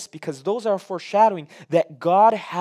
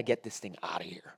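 fearful whisper; 8 semitones lower, 9 dB quieter than usual. Acted. Philistines voiced, afraid of the ark.

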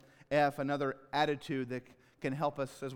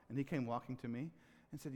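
ordinary delivery

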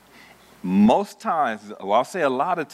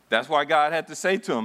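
ordinary delivery

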